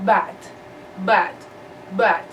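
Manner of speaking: bad said with short open a, repeated; teacher modelling bad for students; model pronunciation. Shown as a demonstration. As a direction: repeat bad slowly for the class to copy.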